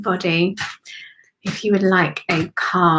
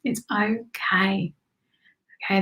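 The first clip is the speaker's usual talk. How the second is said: usual speaking voice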